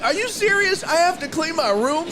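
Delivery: high-pitched mock disbelief; protesting kid. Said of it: "I'd be like".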